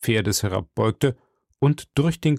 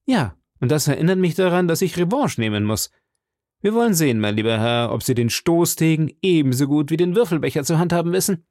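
higher, heated challenge; calling out; 6 semitones higher, faster than usual. Acted. D'Artagnan calls out to the Englishman, higher heated challenge. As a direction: fling the words at him.

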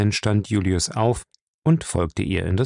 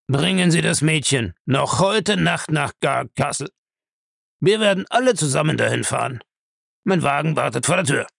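raised, brisk instructions; Bellamy, commanding; 6 semitones higher than usual. Acted. Bellamy orders the girl taken to Gar Castle, commanding. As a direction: give the orders briskly, expecting obedience.

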